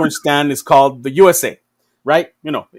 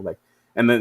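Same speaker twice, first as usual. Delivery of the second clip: normal talking voice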